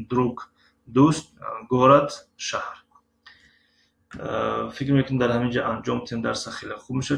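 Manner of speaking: normal talking voice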